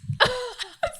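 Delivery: high bright burst of laughter. Real, not acted. Mother laughing at her own postpartum fears, amused. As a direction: laugh out at the memory.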